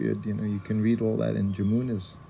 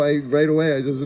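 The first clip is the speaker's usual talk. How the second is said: normal talking voice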